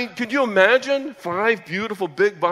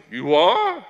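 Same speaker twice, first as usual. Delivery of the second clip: surprised brother; 3 semitones higher, 7 dB louder than usual. Retold or imitated. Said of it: "He goes".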